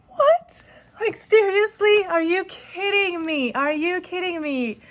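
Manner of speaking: high-pitched, drawn-out incredulity; retold disbelief. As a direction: act out being stunned by good news, stretching the words. Retold disbelief at interview call.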